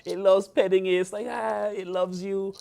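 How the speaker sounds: put-on high, squealing baby-talk gush; cooing over a cute lamb at the petting zoo; gushing over cute animal. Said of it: "you're like".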